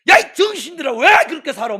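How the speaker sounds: re-enacted full-force telling-off, high and loud; scolding shout. Pastor scolding the church's young people, shouting. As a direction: roar at them to wake them up. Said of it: "청년한테 막 호통 쳤어요".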